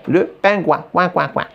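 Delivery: cri de pingouin pour rire, plus aigu; imitation de pingouin. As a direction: faire le pingouin, petits cris brefs et joueurs. Imite en riant le pingouin. (imitation amusée du cri du pingouin)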